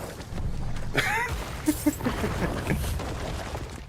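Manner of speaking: long high-pitched, breathless laughter; real laughing fit. Real, not acted. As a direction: crack up helplessly in a high wheeze. Laughs at the failed accent.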